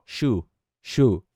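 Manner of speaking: "should" said as "shu", final d left off; "should" cut down to a bare "shu"; dropped D. Shown as a demonstration. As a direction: say "should" without its D. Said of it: "O D você não vai falar".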